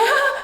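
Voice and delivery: high mock scream; short high cry imitating a baby in pain; imitated screaming. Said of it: "she's screaming her guts".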